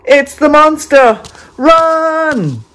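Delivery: high, loud frightened shout of discovery; alarmed cry. A narrator's reading. Narrator to child listeners, crying out in alarm. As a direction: shriek it as the monster appears.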